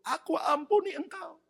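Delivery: high-pitched, heartfelt outburst; emotional forgiveness. Retold or imitated. Wife embracing her husband's mistress, emotional high cry.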